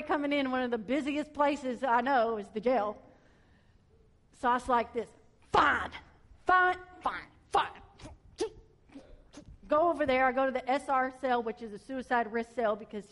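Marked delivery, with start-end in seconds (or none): 5.5-7.7 grudging fine, fine, fine, the middle ones higher; reluctant giving in. Retold or imitated. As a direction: sigh out each fine as you give up.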